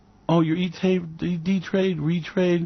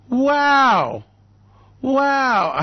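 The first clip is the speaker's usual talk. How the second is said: loud, high mock wow; mock amazement; 8 semitones higher, 5 dB louder than usual. Mocking Trump chump trades, loud high wow. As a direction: gasp it out, wide-eyed and loud.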